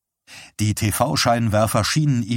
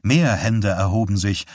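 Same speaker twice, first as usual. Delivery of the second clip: usual speaking voice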